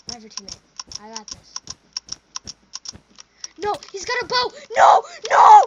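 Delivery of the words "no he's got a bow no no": high, loud panicked shout; panicked yell. Real, not acted. Gamer panicking at an enemy bow, loud yell.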